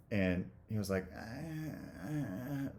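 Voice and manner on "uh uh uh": a dazed, halting uh-uh, much higher and much softer; imitating flustered stammer. Dad at the car show, too stunned to speak, halting mock stammer. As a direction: mimic a man lost for words, voice drifting off. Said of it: "like that".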